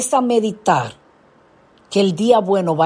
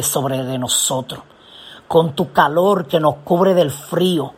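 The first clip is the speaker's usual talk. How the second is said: lower-pitched, earnest praying voice; prayer; 4 semitones lower than usual. Acted. Preacher thanks God as guardian for the congregation, low earnest prayer. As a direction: pray it steadily, pitched below the preaching.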